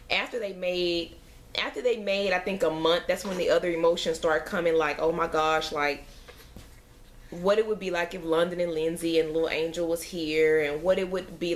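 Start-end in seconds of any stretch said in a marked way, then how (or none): none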